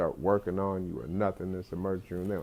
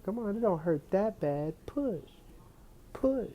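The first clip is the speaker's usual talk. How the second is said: coaxing lady voice; 12 semitones higher than usual. Retold or imitated. Nurse coaxing the patient to push, high mock sweetness. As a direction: urge him gently to push like a midwife. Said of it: "is what the lady was saying".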